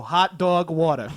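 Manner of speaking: slow, broad Long Island drawl on hot dog water; dopey Long Island accent. Shown as a demonstration. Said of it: "say hot dog water in his dopey accent".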